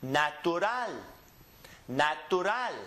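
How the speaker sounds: natural in Spanish, na-tu-RAL; Spanish end stress. Shown as a demonstration. Teacher demonstrates words ending in L, natural stressed on RAL. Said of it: "We go up on the N".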